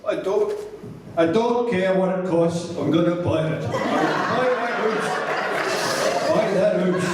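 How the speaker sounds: hammed-up Welsh voice, half laughing; Welsh accent.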